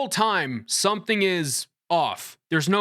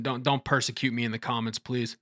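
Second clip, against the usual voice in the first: ordinary talk, nothing marked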